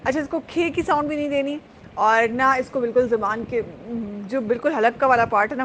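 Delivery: ordinary delivery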